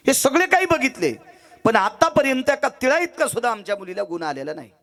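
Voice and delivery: high, insistent preaching pitch; impassioned lament. Acted. Father telling the saint no remedy has worked, impassioned. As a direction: plead that everything has failed.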